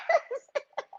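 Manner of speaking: shrieking giggle, very high; high squealing laugh. Real, not acted. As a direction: let out a high excited laugh. Laughing after thrilled scream.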